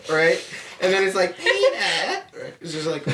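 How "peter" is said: high Lois voice. Retold or imitated. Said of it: "Family Guy voices".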